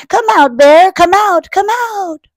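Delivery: high, loud storybook call; calling shout. Acted. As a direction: call out for a hiding friend. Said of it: "and Bunny shout".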